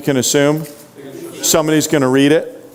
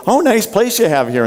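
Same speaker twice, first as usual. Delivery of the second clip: mock-polite, fake-nice compliment, pitched up; insincere compliment; 6 semitones higher than usual. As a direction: gush over the house without meaning it. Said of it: "it was so insincere".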